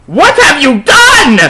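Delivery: high, loud, slow hammed-up wail; overacted grief from an old wizard; mock sobbing cry; crying wisely. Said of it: "he started to cry wisely".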